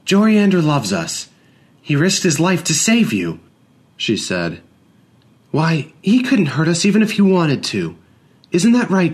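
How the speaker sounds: mother vouching for the servant; earnest mother. A narrator's reading. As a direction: insist firmly to the child. Madeline defends servant.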